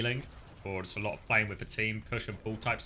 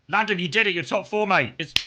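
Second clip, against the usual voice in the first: excited; 7 semitones higher, 6 dB louder than usual. Real, not acted. Congratulating a player on top four.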